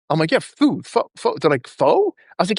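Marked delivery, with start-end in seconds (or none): 0.3-1.4 1 playing dumb. Playing dumb about pho. 1.7-2.1 1 puzzled correction. Retold or imitated. Clients correcting him, puzzled.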